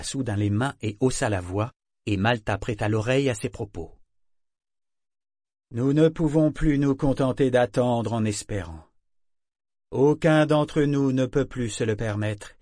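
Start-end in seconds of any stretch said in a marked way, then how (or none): 5.7-12.6 raised speech voice. A narrator's reading. Matriarch addresses the assembly.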